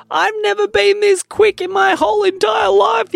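high shouted thrill; yelling. A narrator's reading. Judah yelling down the steep slide, high and loud. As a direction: yell it over the rush of speed. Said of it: "yelled Judah".

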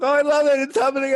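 high-pitched fangirl squeal; excited gushing. Retold or imitated. Visitor recalls falling in love with British accents, excited gushing. Retold gush on loving the accent.

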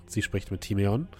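ordinary talk, nothing marked

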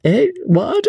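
high, airy "Eh, what?" of a silly dandy; mock-startled high dandy query; foppish surprise. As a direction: play the empty-headed fop. Fop feigns surprise.

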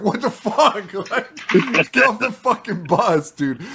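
high, loud mock scolding through laughter; exasperated dad. Dad retelling his reply to his son, exasperated. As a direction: act out exasperation, laughing as you go.

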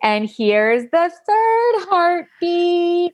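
high, slow, announcing voice; sing-song reveal. Retold or imitated. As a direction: draw out each word like a big reveal. Ultrasound tech announcing heartbeats.

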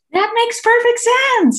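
high, loud, drawn-out mock delight; overjoyed. Acupuncturist clapping at the diagnosis, overjoyed. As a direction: exclaim it slowly, as if thrilled. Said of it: "Why are you so happy?".

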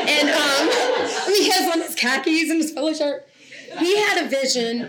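amused laughter about her husband; laughing. Real, not acted. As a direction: giggle at how unlikely he is.

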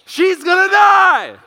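high, loud, drawn-out wail of dread; panicked scream. Retold or imitated. Dad re-enacting his freak-out as wife frees daughter, hysterical screaming. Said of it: "while I'm standing there going".

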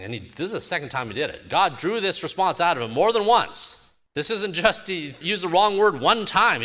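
usual speaking voice

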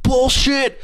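high, huffy mock threat; threatening homeowner. Homeowner threatening to call the cops, high huffy voice. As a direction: puff up like a petty tyrant.

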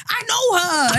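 high, whining insistence; whiny sister. Retold or imitated. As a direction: protest like a hurt little sister. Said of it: "She was like".

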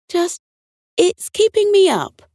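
high, hesitant protest; polite complaint. A narrator's reading. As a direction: lift the pitch and break it to them delicately. Politely complaining about the singing.

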